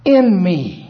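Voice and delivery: high, slow, forceful preacher's declamation; preaching. Acted. Preacher proclaiming Jesus's words to the congregation, preaching high and slow. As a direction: proclaim it, drawing out each phrase.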